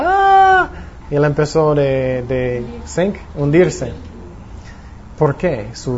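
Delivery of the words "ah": sudden high yelp of fright as he sinks; mock panicked shriek; frightened gasp. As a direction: cry out like a man going under. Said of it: "Y él era".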